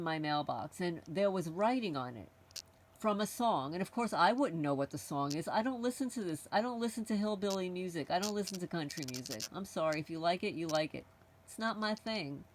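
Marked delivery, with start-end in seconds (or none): none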